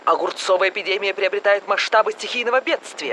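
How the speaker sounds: brisk announcer read; news bulletin. Acted. TV newsreader announces the cucumber epidemic.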